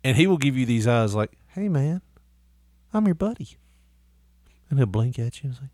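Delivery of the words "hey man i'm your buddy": higher pleading dog voice; mock sweet dog voice; voicing the dog. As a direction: play the dog begging to be your buddy.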